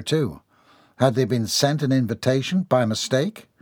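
ordinary delivery

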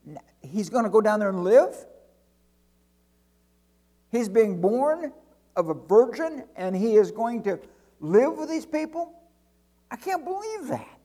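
high, loud disbelief climbing to a squeak; incredulous angel. Acted. Angel hearing God will live with people, incredulous. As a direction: act an astounded angel, pitch rising to the end. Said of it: "if angels talk like that".

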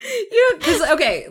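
high, breathless laughter over the words; laughing. Real, not acted. Laughing with co-host at Beckett's odd phrase, giggling. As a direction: crack up while saying it.

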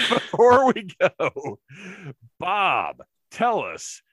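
laughing through the words; giggling restart. Real, not acted. Laughing repeat of sign-off.

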